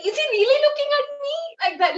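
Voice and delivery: very high, rushed incredulous question; amazed disbelief. Retold or imitated. Devotee to herself as the guru looks at her, amazed. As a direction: relive the moment in wide-eyed disbelief.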